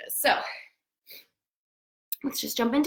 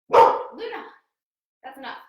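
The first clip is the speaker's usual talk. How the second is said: calling dog sharply; 5 dB louder than usual. Real, not acted. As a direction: call the dog off mid-set.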